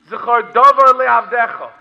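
loud spoken Hebrew verse; preacher's emphatic citation; forceful declaiming. Retold or imitated. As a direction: proclaim the verse, spoken instead of sung. Sung verse now declaimed.

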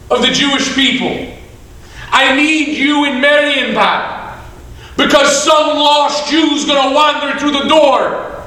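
high, loud, impassioned order; urgent command. Acted. God sending the great rabbi to Marienbad, urgent.

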